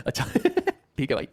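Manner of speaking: real laugh breaking into words. Real, not acted. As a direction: laugh it off, pitch up. Teacher laughs at class. (हँसते हुए)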